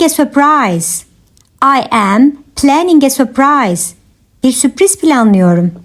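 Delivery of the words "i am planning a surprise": measured, separated phrases; careful teaching repeat. Shown as a demonstration. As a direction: pause after "I am". Teacher repeats answer for learners.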